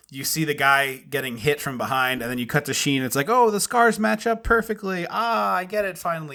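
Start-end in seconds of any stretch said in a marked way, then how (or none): 3.3-6.4 higher, hammed-up "aha" of discovery; mock realization. Co-host mocking the film's late reveal, feigned revelation. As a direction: sarcastically act out a sudden dawning.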